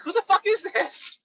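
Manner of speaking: high, indignant who the fuck; incredulous call-out. Retold or imitated. Answering a pushy telemarketer, high and indignant. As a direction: snap back at a stranger, high. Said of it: "I'd be like".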